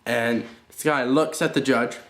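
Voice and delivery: ordinary talk, nothing marked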